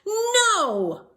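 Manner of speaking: high, loud shout; family shouting together; group yell. Acted. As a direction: shout it with everyone. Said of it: "all yelled".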